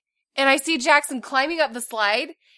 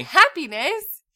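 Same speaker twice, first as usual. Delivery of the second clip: sarcastic; 5 semitones higher, 5 dB louder, slower than usual. Real, not acted. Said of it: "That sure sounded like sarcasm".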